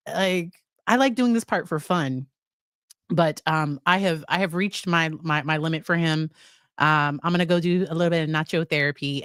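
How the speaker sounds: ordinary delivery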